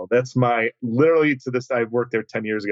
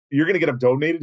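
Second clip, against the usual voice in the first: raised, rising disbelief on a retold question; higher-pitched, questioning repeat; incredulous echo; 4 semitones higher, faster than usual. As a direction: throw the offer back as a doubting question. Echoes the offer in disbelief.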